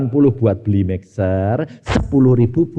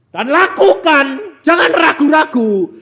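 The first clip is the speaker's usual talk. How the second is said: preaching exhortation; 10 semitones higher, 5 dB louder, slower than usual. Acted.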